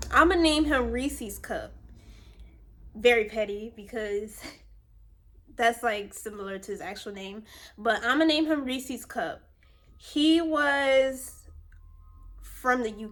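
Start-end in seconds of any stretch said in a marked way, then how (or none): none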